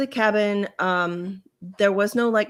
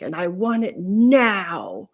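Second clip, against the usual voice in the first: mock pissed demand; slower than usual. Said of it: "so pissed".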